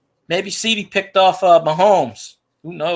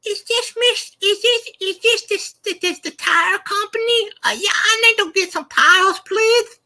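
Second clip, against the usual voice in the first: very high, halting put-on caller; stammering prank-call voice; Waterboy; 12 semitones higher than usual. Shown as a demonstration. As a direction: disguise your voice as a goofy high-pitched customer. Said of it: "You sound like Waterboy".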